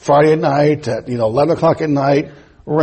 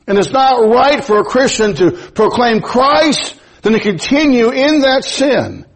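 raised-pitch pulpit proclamation; emphatic preaching; 6 semitones higher than usual. Acted. Preacher tells Christians not to continue in sin, emphatic preaching. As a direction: drive each point home to the congregation.

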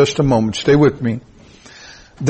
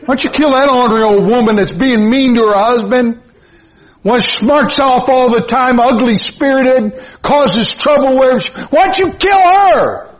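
retold bitter prayer; 8 semitones higher than usual. Preacher reenacting his angry prayer to God, rising to a cry. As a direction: pray in mounting indignation, ending much higher. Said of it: "one day I said".

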